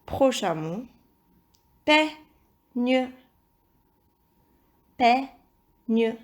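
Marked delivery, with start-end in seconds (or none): 1.8-3.1 peigne. split into pei and gne; measured peigne., final gne fully sounded; syllable by syllable. Shown as a demonstration. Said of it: "il s'agit de la deuxième syllabe". 5.0-6.2 Peigne. as two separated syllables. Shown as a demonstration. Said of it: "il s'agit de la deuxième syllabe".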